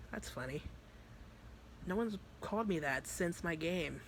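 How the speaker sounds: soft, cool retort; understated menace, kept quiet; threatening. Retold or imitated. Monica answering Vice, quietly threatening. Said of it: "they just literally were just threatening each other".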